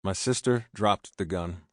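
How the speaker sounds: usual speaking voice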